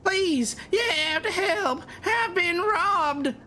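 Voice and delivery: high, wailing robbery victim; distressed plea. Acted. Robbed woman begs passers-by for help, distressed. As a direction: cry out high and drawn-out, pleading.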